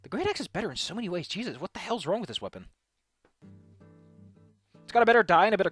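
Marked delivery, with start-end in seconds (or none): none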